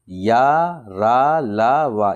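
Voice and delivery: या, रा, ला, वा each stretched on a long aa; letters drawn out. Shown as a demonstration. Teacher listing the four letters for learners, each one drawn out. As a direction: chant out या, रा, ला, वा one by one, loudly.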